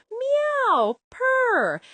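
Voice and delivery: high sung meow and purr; cat singing meow. Acted. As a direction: sing it like a cat. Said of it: "Cat sang".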